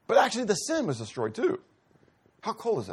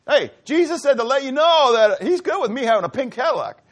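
higher, louder wheedling plea to God; pushy petitioner; 6 semitones higher, 6 dB louder than usual. Retold or imitated. Pastor playing a pushy believer asking God, higher wheedling plea. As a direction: coax God like a salesman with a deal.

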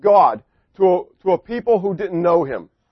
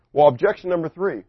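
usual speaking voice

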